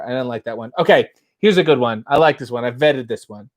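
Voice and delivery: usual speaking voice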